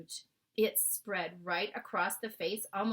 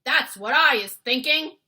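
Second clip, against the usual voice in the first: loud, big giant voice; hulking giant bellowing; booming giant; 8 semitones higher, 15 dB louder than usual. Acted. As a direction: boom it out like a huge giant. Giant voice in read story.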